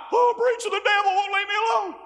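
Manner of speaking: high, loud mock complaint; hammed-up whine to the pastor; whining church member. Congregant complaining to the preacher, high mock whine.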